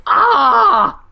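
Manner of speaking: high, loud hammed-up wail; drawn-out pretend agony cry; mock pain scream. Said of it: "he's just like".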